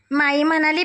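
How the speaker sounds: usual speaking voice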